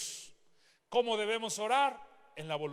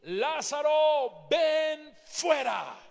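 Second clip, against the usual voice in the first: slow, commanding call, much higher and louder; 7 semitones higher, 6 dB louder, much slower than usual. Acted. Jesus calling Lázaro out of the tomb, loud slow command. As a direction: call a dead man out of the tomb. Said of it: "clamó a gran voz".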